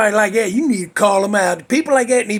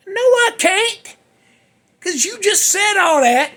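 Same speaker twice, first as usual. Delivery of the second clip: very high-pitched, exasperated retort; indignant mock protest; 14 semitones higher, 3 dB louder than usual. Letter guy refusing the jerk's offer, indignant mock protest. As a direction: squeal the objection, dragging the first words.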